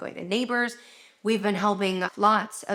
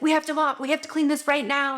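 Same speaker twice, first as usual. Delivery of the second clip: urgent; 7 semitones higher, faster than usual. Retold or imitated. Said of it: "it's like".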